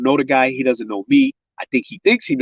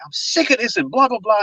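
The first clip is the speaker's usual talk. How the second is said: retold yelling; 11 semitones higher than usual. Enraged man into the phone, retold mock yelling. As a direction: imitate someone fed up, pitched way up. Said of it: "He's yelling into the phone".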